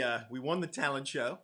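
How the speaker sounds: usual speaking voice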